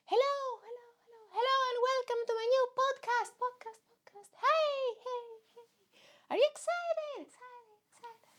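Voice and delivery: high, showy intro with echoes; excited podcast host. Acted. Host welcoming listeners to a new podcast, high hype. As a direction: sing out the welcome, fading on each repeat.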